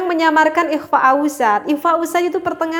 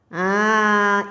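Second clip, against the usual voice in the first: normal talking voice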